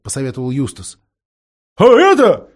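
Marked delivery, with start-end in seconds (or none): 1.8-2.5 high, loud, slow owl shout; owl shouting with all her might. A narrator's reading. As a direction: yell it for the deaf lord. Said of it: "изо всех сил прокричала сова".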